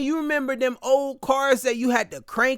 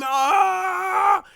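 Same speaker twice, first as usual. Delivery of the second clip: mock scream; 10 semitones higher than usual. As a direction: let out a hammed-up yelp of pain. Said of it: "I'm screaming".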